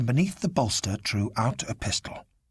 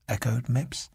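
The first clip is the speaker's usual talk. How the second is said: ordinary delivery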